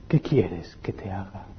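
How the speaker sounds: slow, gentle whisper; Jesus whispering to the blind man, slow and gentle; soft whisper. Acted. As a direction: lean in and whisper the question.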